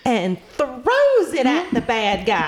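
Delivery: slow sweet southern drawl. Shown as a demonstration. Player describing her character in a slow southern drawl. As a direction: drag it out like a southern lady. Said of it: "Now you sound like the lady".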